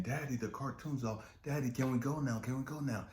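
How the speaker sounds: put-on high child's voice begging; eager little kid pleading to play; a small son begging his dad to play catch; little boy.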